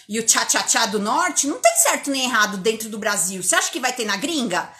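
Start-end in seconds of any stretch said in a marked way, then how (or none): none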